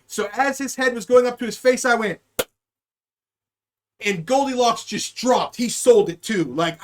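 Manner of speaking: ordinary talk, nothing marked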